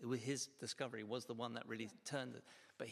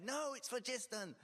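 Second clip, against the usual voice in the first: imitating stubborn insistence, pitched up; stubborn denial; 9 semitones higher than usual. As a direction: act out a scientist refusing to budge, higher voice. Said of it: "he still disputed it till he died".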